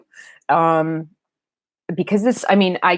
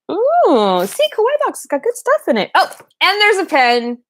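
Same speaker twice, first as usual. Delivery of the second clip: much higher, delighted gushing; bright unboxing excitement; excited squeal; 11 semitones higher than usual. Real, not acted. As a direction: squeal with joy over the box. Unboxing a gift box.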